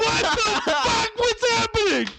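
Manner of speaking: high, squealing disbelief with a laugh; mock-horrified squeal at the drive-thru window; shocked disbelief. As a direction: shriek it like the world just ended. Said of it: "And I'm like".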